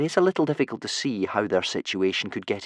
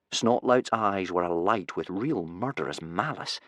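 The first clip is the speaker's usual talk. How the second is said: ordinary delivery, nothing marked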